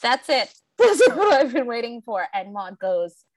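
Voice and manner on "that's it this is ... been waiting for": higher, louder excited outburst breaking into laughter; retold shriek. Viewer retelling her reaction to the movie's demon scene, excited mock shriek. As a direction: re-enact a thrilled shriek at the screen, half laughing. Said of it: "I literally shrieked".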